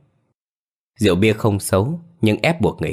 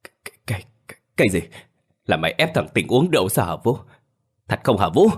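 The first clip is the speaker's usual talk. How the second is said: trembling; faster than usual. A narrator's reading. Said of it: "Ông Tự run rẩy nói".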